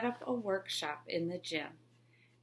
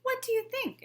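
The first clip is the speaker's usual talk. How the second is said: much higher, teasing question; sly trickster; 4 dB louder than usual. Acted. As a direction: bait her with it. Trickster tests Miss Moore.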